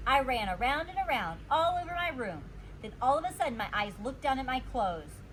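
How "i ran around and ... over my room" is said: usual speaking voice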